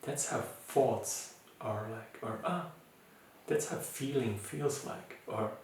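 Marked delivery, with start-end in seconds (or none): 0.0-2.2 soft, tender "ah", then gently naming it; name it softly, with loving acceptance; very gentle, beautiful, loving tone. Shown as a demonstration. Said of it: "very loving voice and say". 2.4-5.2 soft, tender "ah", then gently naming it; name it softly, with loving acceptance; very gentle, beautiful, loving tone. Shown as a demonstration. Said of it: "very loving voice and say".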